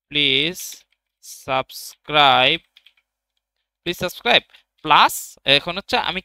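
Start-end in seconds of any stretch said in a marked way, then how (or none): 0.1-4.4 slow, drawn-out dictation while typing; subscribe stretched out slowly, rising at the end. Shown as a demonstration. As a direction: spell it out slowly as you type. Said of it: "এভাবে না দিয়ে যে please".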